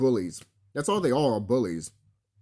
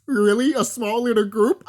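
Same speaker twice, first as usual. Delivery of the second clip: mocking disbelief; 7 semitones higher, 4 dB louder than usual. Mocking enemies' disappointed voice.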